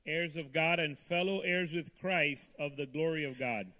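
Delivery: usual speaking voice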